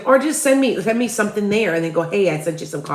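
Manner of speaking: ordinary talk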